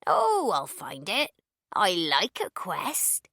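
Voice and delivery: put-on slow, sing-song elderly neighbour; slow old lady voice. A narrator's reading. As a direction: draw it out like a chirpy old woman. Old neighbour says, smiling.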